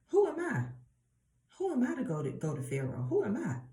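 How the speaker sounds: high-pitched incredulous questioning; self-doubting protest. Acted. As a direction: act out Moses balking, pitch leaping on each question. Acting Moses protesting his calling.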